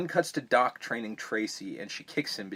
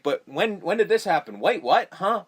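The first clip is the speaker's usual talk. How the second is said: mock confusion; 8 semitones higher, 6 dB louder than usual. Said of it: "And you're like".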